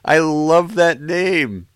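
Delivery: high, loud delight after a laugh; losing the straight face, tickled and high; real amusement. Real, not acted. Host tickled by a band name, laughingly delighted. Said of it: "Can't do it with a straight face".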